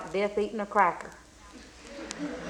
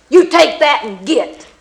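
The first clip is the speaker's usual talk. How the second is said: loud rough snap at the customer; mean blacksmith barking at a customer; gruff bark; 3 semitones higher, 9 dB louder than usual. Acted. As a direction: throw the words like a horseshoe. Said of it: "That's just the way he talked".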